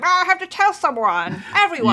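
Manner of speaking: high-pitched imitation of someone bursting with news; blurting it out. Contestant who couldn't help herself spilling her secret, high mock blurt. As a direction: burst out in a mock can't-hold-it-in voice. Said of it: "blurted it out".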